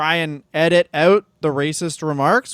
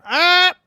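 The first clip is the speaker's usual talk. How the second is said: short yelp; 12 semitones higher, 4 dB louder than usual. Pretend. Shout before bleeps.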